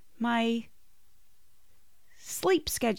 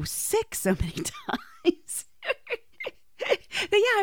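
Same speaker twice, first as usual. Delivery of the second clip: laughing; 14 semitones higher, slower than usual. Real, not acted. Laughing through her food poisoning story.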